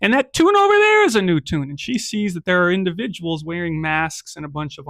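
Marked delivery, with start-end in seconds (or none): 0.0-1.7 alarmed realization. Acted.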